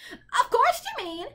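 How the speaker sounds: high, pushy bird voice; interrupting bird. A narrator's reading. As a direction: cut in with a high squawk. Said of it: "interrupted the bird".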